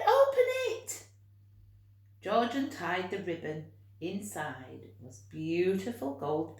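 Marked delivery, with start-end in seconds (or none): 0.1-1.1 very high, loud animal chorus; animal voices. A narrator's reading. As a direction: squeak it like excited little animals. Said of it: "they said".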